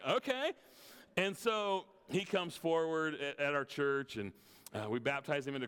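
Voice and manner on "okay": high, startled okay; taken aback, say okay; pastor's bewildered okay; surprised okay. Retold or imitated. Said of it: "I was like".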